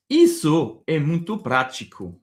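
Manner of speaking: "Isso é muito prático" in clear Brazilian Portuguese; Portuguese model sentence. Shown as a demonstration. Teacher models the Portuguese sentence for learners, clear Portuguese.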